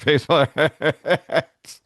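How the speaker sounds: echoed through a laugh. Real, not acted. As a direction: laugh while repeating it. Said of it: "that's a sports reference".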